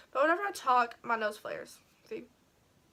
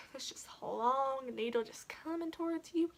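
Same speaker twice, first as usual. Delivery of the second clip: 1 whisper; 7 dB quieter than usual. Retold or imitated. Describing the needle coming closer.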